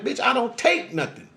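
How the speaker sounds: high, sharp indignant refusal. Retold or imitated. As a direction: snap back in disbelief.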